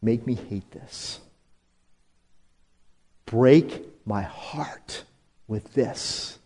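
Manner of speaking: earnest prayer. Retold or imitated. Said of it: "I say, God".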